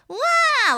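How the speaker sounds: high wailing wah; crying wah demo. Shown as a demonstration. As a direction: wail it like a cry. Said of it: "Like, crying".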